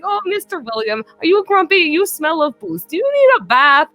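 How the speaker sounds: cheery Grendel voice. Acted. Grendel comforts hungover William, lightly sweet fussing. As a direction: fuss over a grumpy friend. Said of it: "So, like".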